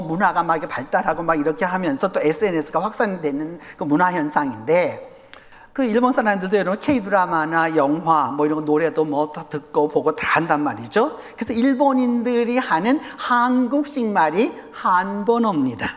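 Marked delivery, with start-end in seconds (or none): none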